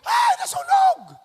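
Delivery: very high mock scream; a neighbour shrieking that the house is on fire. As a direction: shriek it like a panicked neighbour, hammed up.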